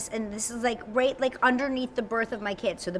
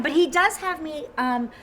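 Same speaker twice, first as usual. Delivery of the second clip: usual speaking voice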